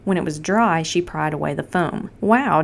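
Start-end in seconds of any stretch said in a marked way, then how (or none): 2.2-2.6 a bright, louder wow; amazed exclamation; exclaiming. A narrator's reading. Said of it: "Joe exclaimed".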